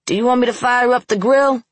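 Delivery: high, loud yell from outside. A narrator's reading. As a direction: yell the question across the house. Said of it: "Rome yelled".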